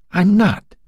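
high, anguished denial; silent inner cry. A narrator's reading. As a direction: protest desperately to yourself. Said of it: "he cried silently to himself".

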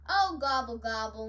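loud "oh" falling into a moan; mild turkey moan. A narrator's reading. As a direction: moan in storybook dismay. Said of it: "moaned Turkey".

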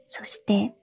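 plain reading voice

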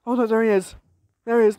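ordinary talk

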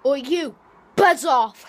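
loud yell at bee; shouting. Real, not acted. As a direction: bark it away. Yelling at bee.

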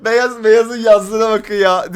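high, loud laughter; can barely talk for laughing; laughing hard. Real, not acted. Cracking up at chat.

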